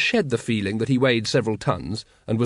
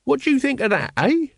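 very high-pitched, questioning character voice; Mooty; 14 semitones higher than usual. A narrator's reading. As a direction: prod for an answer in a raised, squeaky pitch. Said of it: "said Mooty".

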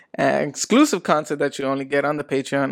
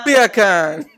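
loud, laughing mock-Jamaican punchline; higher, gleeful Jamaican lilt; Jamaican accent; 5 semitones higher, 8 dB louder than usual. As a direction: land it in a big Jamaican accent. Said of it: "how to say bacon in Jamaican".

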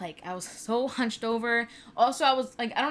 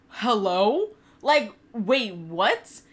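louder, stunned hello then wait, what; shocked disbelief; 8 dB louder, much slower than usual. Retold or imitated. New mother overhearing nurses, stunned and indignant. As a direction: react like you can't believe your ears.

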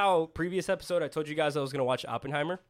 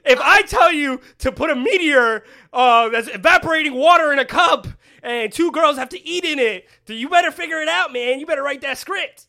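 loud, high ranting shout; 11 semitones higher, 12 dB louder than usual. Acted. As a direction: rant it at full volume. Heated joking rant at cohost.

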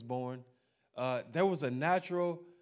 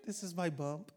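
soft, much quieter proud mother-to-be; doting pregnant woman; 3 semitones higher, 8 dB quieter than usual. Retold or imitated. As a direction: coo it like a proud expectant mother.

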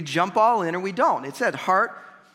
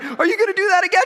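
much higher, louder, fast teasing question; excited mock question; 12 semitones higher, 6 dB louder, faster than usual. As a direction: fire it off eagerly like the cheery instructor. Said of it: "the guy's like".

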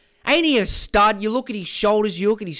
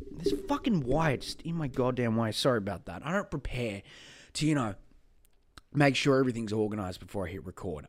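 muttered aside; 7 semitones lower, 9 dB quieter, faster than usual. Real, not acted.

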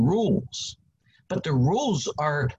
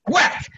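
mock whack; 14 semitones higher, 5 dB louder than usual. Mock hitting a complainer.

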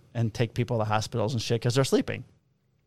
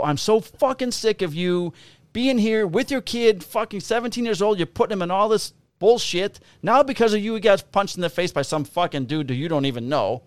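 raised, high-pitched tirade; retold angry outburst; 8 semitones higher, 3 dB louder than usual. Officer blowing up at a mother over her kid, angry. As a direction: go off on her, drawing out the first words. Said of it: "I just lost my shit".

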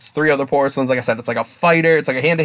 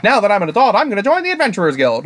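put-on eager young recruit; 6 semitones higher, faster than usual. Voices eager fifteen-year-old priestess.

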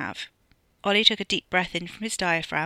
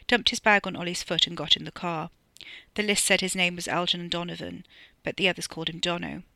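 usual speaking voice

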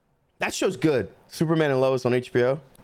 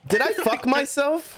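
mock dismay; 10 semitones higher than usual. Real, not acted. Mock dismay, slow high.

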